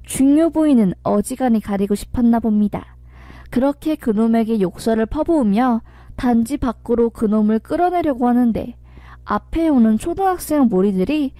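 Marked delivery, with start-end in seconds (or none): none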